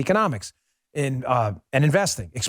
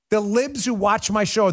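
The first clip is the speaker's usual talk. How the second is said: usual speaking voice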